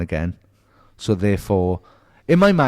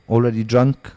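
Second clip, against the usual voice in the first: ordinary talk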